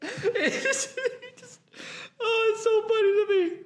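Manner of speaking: high, breaking with laughter; laughing to tears. Real, not acted. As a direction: squeak through helpless laughter. Laughing so hard he cries.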